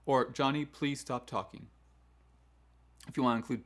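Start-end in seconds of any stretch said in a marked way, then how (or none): 0.3-1.7 "talking" falling at the end, no question; firm statement, voice dropping on "talking"; downward inflection. Shown as a demonstration. Teacher telling Johnny to stop, "talking" falling. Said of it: "inflection needs to go down in your voice".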